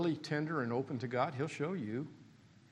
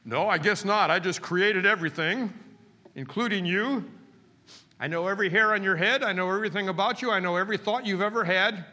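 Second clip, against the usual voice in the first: loud, raised mock reply from God; 5 semitones higher, 7 dB louder than usual. Preacher booming God's answer to the complaint. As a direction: bellow it back as God, incredulous.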